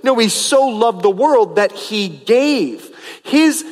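ordinary delivery

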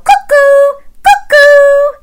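very high, loud cuckoo; cuckoo call. Shown as a demonstration. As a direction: sing out like a clock bird. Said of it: "add in a cuckoo".

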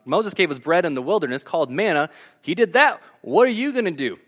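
ordinary talk

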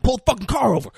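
high-pitched urgent demand, acted out; retold freak-out. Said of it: "he freaks out".

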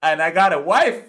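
high, laughing put-on voice; mock inner voice. Excusing himself to the stranded hiker, high and laughing. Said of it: "in my mind I'm like".

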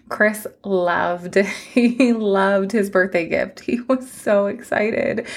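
slow, teary words through a small laugh; holding back tears, slowed right down; choked up. Real, not acted. Telling listeners how her husband loved his gift, choked up. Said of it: "I'm just a mess".

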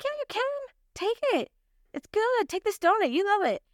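high, insistent coaxing to take the donut; playful coaxing. Retold or imitated. Urging the shy clerk to take one.

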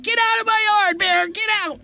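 high-pitched mock yell at the bear. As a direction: shoo the bear off in a squeaky, high holler. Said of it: "I yelled at the bear".

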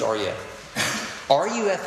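spelling "R U F" out for the crowd; slow letter-by-letter spelling. Shown as a demonstration. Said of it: "spelling out the letters slowly".

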